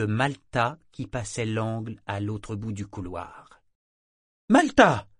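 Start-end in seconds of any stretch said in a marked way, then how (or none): 4.5-5.1 sharp high snap of a name; curt call. A narrator's reading. Mother calling her daughter sharply in the corridor. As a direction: bark the girl's name curtly. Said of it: "fit-elle sèchement".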